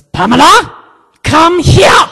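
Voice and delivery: angry supervisor's shouted summons; Helga. Retold or imitated. Supervisor calling agent to the front, furious. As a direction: shout it high and imperious, imitating the boss.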